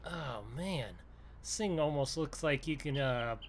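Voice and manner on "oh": ordinary delivery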